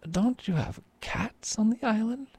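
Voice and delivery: a high, shocked merchant's voice; horrified Antonio. A narrator's reading. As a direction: ask it high and aghast. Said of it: "he said".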